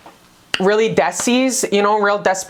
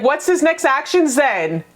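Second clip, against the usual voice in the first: pitched way up, pointed demand; pushy higher question; 7 semitones higher than usual. Pretend. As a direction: ask it high and impatient. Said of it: "You're like".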